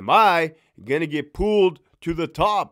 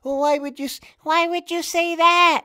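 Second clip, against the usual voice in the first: high, fake little laugh into a mock woman's voice; mock female boss; 11 semitones higher than usual. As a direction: play her fake sweetness with a lifted voice.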